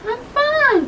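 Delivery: high woman voice. Acted.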